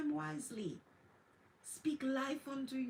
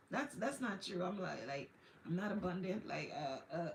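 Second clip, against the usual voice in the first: doubtful mumble; 6 semitones lower, 6 dB quieter than usual. Acted. Mocking doubters' self-talk.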